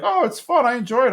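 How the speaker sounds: lightly voiced girl, pitched high; high-pitched, mock-enthusiastic retold reply; imitating Connie. Voicing Connie's cheerful reply.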